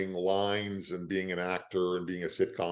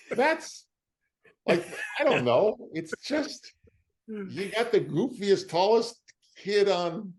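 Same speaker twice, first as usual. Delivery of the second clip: excited disbelief; 11 semitones higher, slower than usual. Real, not acted. Amazed retelling.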